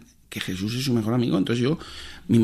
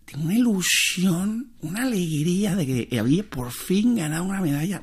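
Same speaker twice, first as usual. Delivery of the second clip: niño emocionado; 8 semitones higher than usual. Retold or imitated. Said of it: "decía el niño, está como emocionadísimo".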